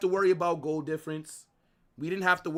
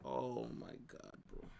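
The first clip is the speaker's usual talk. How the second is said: stunned whisper; 2 semitones lower, 12 dB quieter than usual. Real, not acted. Streamer stunned by team's collapse.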